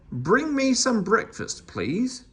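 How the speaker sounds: a mock grand master; lordly demand. A narrator's reading. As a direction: order her about. Meddle orders the woman.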